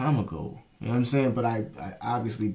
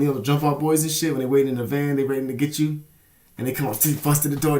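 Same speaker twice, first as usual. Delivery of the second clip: ordinary talk, nothing marked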